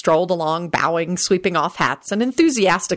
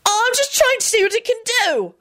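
very high, panicky excuse; panic-stricken. A narrator's reading. Boy excusing the fire, shrill panic. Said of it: "in a panic-stricken voice".

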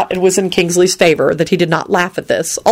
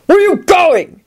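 high, forceful officious demand; harsh challenge; 13 semitones higher, 4 dB louder than usual. A narrator's reading. Guard blocks Neville's path, harsh. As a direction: challenge sharply in a raised pitch. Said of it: "he intoned harshly".

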